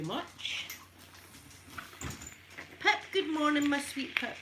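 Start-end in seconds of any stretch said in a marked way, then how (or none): 2.8-4.4 cooing at the dog. Real, not acted. Greeting her dog Pip during the live stream, high doting coo. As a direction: coo up at your little dog like it's the sweetest thing.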